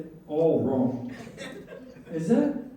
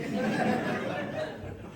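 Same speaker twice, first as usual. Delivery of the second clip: audience laughing; crowd laughter; 6 dB quieter than usual. Real, not acted. Audience laughs after joke.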